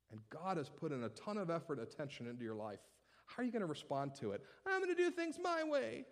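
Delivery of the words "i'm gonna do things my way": put-on stubborn child.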